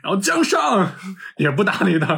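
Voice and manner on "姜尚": calling out from behind. Retold or imitated. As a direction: call after him to make him turn around. Calling after Jiang Ziya. (高声远远地喊)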